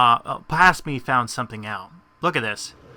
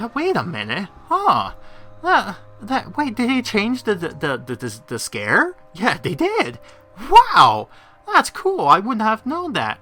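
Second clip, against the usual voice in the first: much higher, louder excited discovery; retold surprise; 9 semitones higher, 5 dB louder than usual. Vlogger retelling spotting the changed scare, high and excited. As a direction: relive the moment of discovery. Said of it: "and I'm like".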